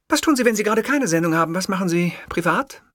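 hurried female reporter; brisk interviewer. Acted. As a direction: rattle off the question. Interviewer tries new approach.

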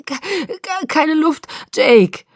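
high, choked stammer bursting out loud at the end; gasping. A narrator's reading. Bella crushed in Jacob's hug, gasping. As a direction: struggle for air between syllables. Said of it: "keuchte ich".

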